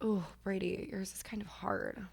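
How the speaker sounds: unvoiced whisper to a friend. Real, not acted. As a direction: whisper it like a secret aside. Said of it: "whispered aside".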